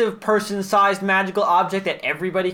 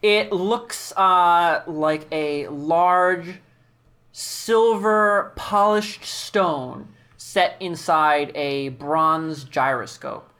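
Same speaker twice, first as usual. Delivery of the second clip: slow scene setting; 4 semitones lower, slower than usual. Acted. GM describing the strange object.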